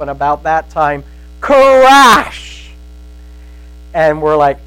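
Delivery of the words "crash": a loud, high mock crash of thunder; storyteller's thunder sound effect; imitated thunder crash. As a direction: shout the thunderclap.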